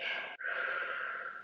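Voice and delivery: long breathy sigh of nausea; shaky sigh. Real, not acted. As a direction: sigh out the queasiness. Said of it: "I had to take a second there".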